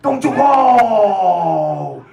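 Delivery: high, drawn-out cries of mock horror; scandalized God. God looking down on Sodom and Gomorrah, hammed-up scandalized cries. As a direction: play a squeamish God shouting down in disgust. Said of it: "You're telling me that God was looking".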